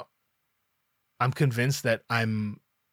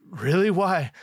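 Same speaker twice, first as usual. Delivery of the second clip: higher-pitched, puzzled questioning; imitating surprised friend; 7 semitones higher, 5 dB louder than usual. DJ to performer who is freaking out, surprised questioning. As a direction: play the friend caught off guard. Retold friend hears of the panic.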